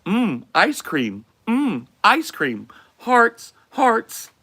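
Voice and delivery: high, looping canned reactions; NPC bot voice. Acted. Streamer acting as an NPC bot reacting to viewer gifts. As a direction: repeat each word like a streaming NPC. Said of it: "You guys wanna try a bot stream".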